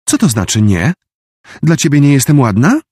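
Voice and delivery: high, indignant schoolgirl; offended girl voice. Acted. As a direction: demand it, hurt and haughty. Offended girl confronts boy. (obrażona dziewczyna)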